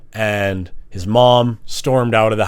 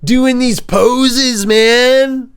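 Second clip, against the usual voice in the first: much higher mock bro; 12 semitones higher, 3 dB louder, slower than usual. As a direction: ham up a leering bro. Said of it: "it's not like".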